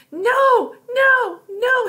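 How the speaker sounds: put-on very high, loud little-kid shriek; rising toddler wail. A narrator's reading. Toddler being wrestled into a backpack, rising shrieks. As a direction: wail each no at full pitch. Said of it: "her voice rising in pitch and intensity".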